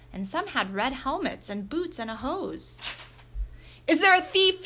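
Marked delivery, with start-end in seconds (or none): 3.9-4.6 high, very loud urgent shout. A narrator's reading. Officer rushing into the room, shouting. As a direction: shout the question across the room. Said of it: "shouted one of the men".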